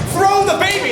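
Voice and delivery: high, urgent crowd cry; mock shout. Crowd shouting advice to the mother, mock shout. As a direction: call it up to the tower, hammed-up. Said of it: "they shouted".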